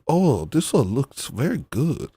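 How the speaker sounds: put-on Chinese-accented English; mock Chinese accent. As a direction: imitate a halting Chinese accent. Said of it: "like, a little bit Chinese at them".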